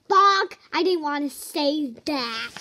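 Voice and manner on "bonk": very loud silly word; loud bonk. Acted. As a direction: blurt it loudly.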